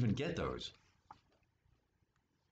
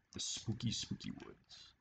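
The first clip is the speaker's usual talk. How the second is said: spooky whisper; 5 semitones lower, 7 dB quieter than usual. A narrator's reading. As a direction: hush it like a ghost story. Spooky woods read to children.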